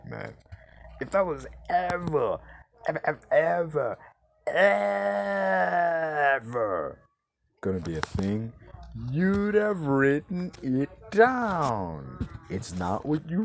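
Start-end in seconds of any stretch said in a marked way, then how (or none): 1.0-7.0 "ever" stretched longer and higher each time; exaggerated, lengthened "ever"; drawn-out EVER. Exaggerates the word with each repeat. 9.1-12.3 very slow, high, stretched words; exaggerated slow-motion exasperation; drawn-out emphasis. Hammers the point home.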